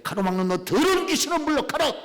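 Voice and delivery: loud cry driving the devil out; commanding rebuke. Shown as a demonstration. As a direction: command the spirit to leave. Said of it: "저주하고 명령하고". (크게 꾸짖는 명령)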